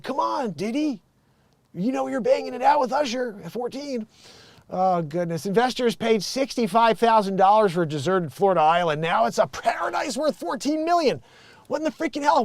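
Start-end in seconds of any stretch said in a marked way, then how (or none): 0.0-4.1 high, scoffing mock jab; mock taunt. Taunting [name] about his accusations, mock scoffing. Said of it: "and then you're like". 11.7-12.4 higher, incredulous exclamation; outraged disbelief. Real, not acted. Reacting to the island's price jump, incredulous.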